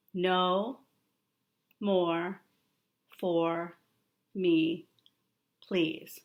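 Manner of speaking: each word alone, long pauses between; slow word-by-word reading for a child. Shown as a demonstration. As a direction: point and read one word at a time. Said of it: "You read it".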